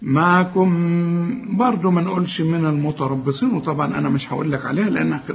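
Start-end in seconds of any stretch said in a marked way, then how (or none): 0.0-1.6 معكم drawn very slowly into the next mim; merged mim. Shown as a demonstration. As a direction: hold the final m of معكم long. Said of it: "إدغام هنا". (إدغام الميم في معكم)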